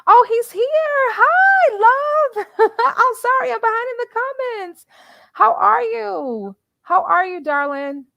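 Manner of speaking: high, loud, delighted hello, slowing on how are you; excited greeting. Real, not acted. Host greeting a guest joining her live stream, high and excited. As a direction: gush over a friend who just showed up.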